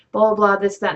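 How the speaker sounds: plain reading voice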